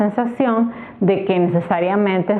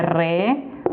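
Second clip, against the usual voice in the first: REVOLUCIÓN split as RE, VO, LU, CIÓN with pauses; syllable by syllable; 3 semitones lower, 3 dB quieter than usual. Shown as a demonstration. Teacher showing how the word divides, syllable by syllable. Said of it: "se separa".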